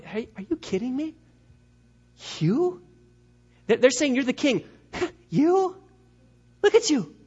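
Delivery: high, incredulous mockery with a scoffing laugh; Pilate's sarcasm. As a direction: scoff at a laughable claim, pitch jumping up. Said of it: "a little sarcasm that was probably in Pilate's voice".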